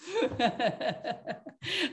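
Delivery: a short evil cackle; mustache twirling villain. Shown as a demonstration. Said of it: "villainous laughter".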